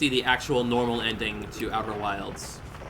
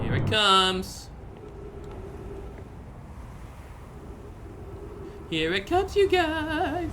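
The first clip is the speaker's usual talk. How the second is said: breathless high anticipation; slow, rising, awestruck build; gobsmacked; much slower than usual. Real, not acted. Anticipating the big event.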